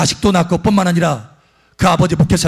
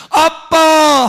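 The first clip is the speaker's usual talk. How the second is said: high, loud joyful cry of "아빠!"; child's cry of dad; 10 semitones higher, 3 dB louder, much slower than usual. Retold or imitated. Healed son suddenly calling his father, high loud cry. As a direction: call out to dad like a healed boy. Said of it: "하고 소리 지르는 거예요".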